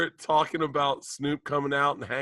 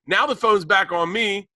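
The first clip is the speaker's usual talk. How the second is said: usual speaking voice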